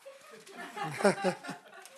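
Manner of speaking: real light chuckle; laugh. Real, not acted. Teacher to the audience after claiming experience, chuckling. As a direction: let out a small unvoiced laugh.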